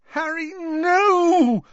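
high, drawn-out anguished moan; long, pleading moan. A narrator's reading. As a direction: wail a desperate plea, very high. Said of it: "moaned Hermione".

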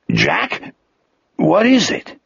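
worried simple creature; Wolf, concerned. Acted.

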